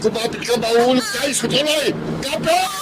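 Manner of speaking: high, urgent cry to hold on; husband crying out as they fall; panicked warning. Acted. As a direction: warn her as the car plunges. Car falling off bridge.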